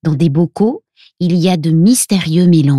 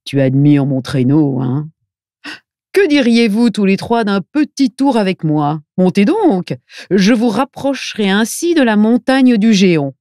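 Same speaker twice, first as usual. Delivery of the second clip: friendly put-on voice, rising into the offer; kindly old fellow; 4 semitones higher than usual. A narrator's reading. Old fellow offers Milo a sleigh ride, kindly character voice. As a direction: make a genial, lilting invitation in character. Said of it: "il dit gentiment à Milo".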